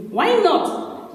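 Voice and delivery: sharp high indignant question; indignant why not. A narrator's reading. Nji retorts to the boy, sharp and indignant. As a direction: fire it back, high and offended.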